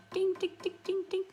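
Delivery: high, tinkling dings; clinking sound. Shown as a demonstration. Teacher showing students what a clink is, high dinging. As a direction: imitate little clinks in a high voice. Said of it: "is kinda like this sound".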